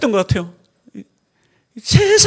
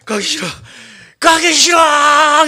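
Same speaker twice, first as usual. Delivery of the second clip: whining; 4 semitones higher, 5 dB louder than usual. Retold or imitated. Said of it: "이러고 제가 가요".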